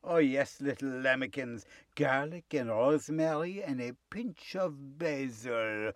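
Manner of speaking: sly charming wolf. Acted. Wolf poses as the sheep's husband, sly coaxing. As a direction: purr it softly, savouring each item.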